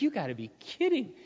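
high, stunned disbelief; shocked, can't believe it; hearing about smoking pot with dad, high disbelief. Retold or imitated. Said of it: "I'm like".

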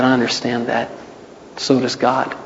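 soft, low comfort; gentle reassurance. Retold or imitated. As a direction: reassure her quietly. Gentle counsel to crying woman.

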